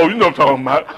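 usual speaking voice

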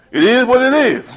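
grandma's voice. Retold or imitated. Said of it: "She said".

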